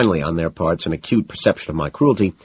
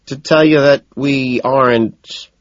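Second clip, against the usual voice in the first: slow, higher, reluctant confession; nervous; 8 semitones higher, 6 dB louder, slower than usual. A narrator's reading. Narrator admits the change of plan, nervous. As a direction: drag it out, dreading her reaction. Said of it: "I gulp".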